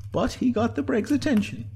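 high-pitched musing; Sinead's inner voice. A narrator's reading. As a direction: think it through in a high voice. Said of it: "thought Sinead".

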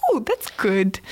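creepy put-on clown voice; Pennywise. Clown answers the boy about friends, creepy. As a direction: imitate a clown's oily approval. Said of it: "stopped talking with that voice".

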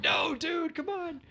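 high, mock-exasperated fan voice; imitating fans. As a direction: squeak it like a scoffing fan. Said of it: "people who saw Rogue One are like".

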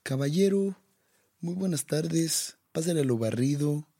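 chilango street accent. Shown as a demonstration. Playing the movie street character, high chilango street accent. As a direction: call out like a chilango street vendor. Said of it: "ese tipo de acento".

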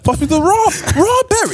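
very high, squeaky "raw berry"; mock squeaky mispronunciation. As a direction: squeak it like a cartoon character. Hamming up the misheard word.

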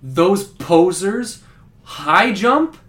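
slow, loud, offended disbelief; indignant scorn. Acted. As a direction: drag it out, deeply offended. Jumper scorns the high jump.

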